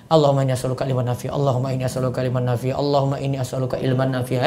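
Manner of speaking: recited low and soft, gradually slowing; repeated prayer. Shown as a demonstration. Teacher leading the class in repeating the prayer, low and soft. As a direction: chant the plea three times. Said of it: "ulang tiga kali".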